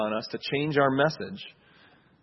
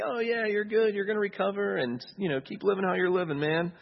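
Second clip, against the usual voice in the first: breezy, higher flattering voice; flattering reassurance; 8 semitones higher than usual. Retold or imitated. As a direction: pat him on the back, smooth and easy. Voicing flattering false message.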